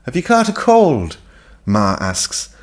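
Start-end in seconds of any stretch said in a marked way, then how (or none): 0.0-1.2 high pointed question; Ma's voice. A narrator's reading. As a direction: pitch the mother up. Ma asks, higher voice.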